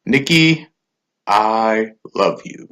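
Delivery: slow robot voice, monotone drawl. Retold or imitated. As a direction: stretch it out like a robot. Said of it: "in his robot voice".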